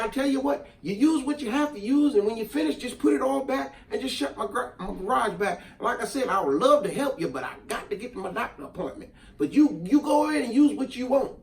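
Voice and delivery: hammed-up Southern neighbor offering help; country neighbor voice. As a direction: play the kind Southern neighbor, easygoing. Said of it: "He probably didn't talk like that for one".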